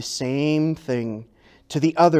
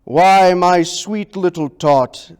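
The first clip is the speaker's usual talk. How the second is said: a touch of sugary fake Santa; fake Santa lying; 6 dB louder than usual. A narrator's reading. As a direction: sweet-talk the child with a lie. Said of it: "the fake Santa Claus lied".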